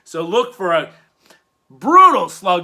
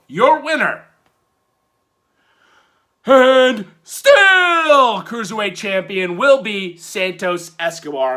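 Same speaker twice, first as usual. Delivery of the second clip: ring announcer call; 3 semitones higher, 5 dB louder than usual. Pretend. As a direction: bellow it out like the arena announcer. Ring-announcer style winner call.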